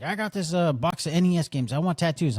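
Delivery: mock bum making his offer; random bum. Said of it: "some random bum came in".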